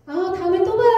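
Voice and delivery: high, sing-out parting line; breezy goodbye. Retold or imitated. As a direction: imitate a carefree farewell. Voicing the sister's breezy goodbye.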